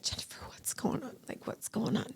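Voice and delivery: hushed, breathless worried whisper; retold hallway plea, whispered softly; anxious whisper.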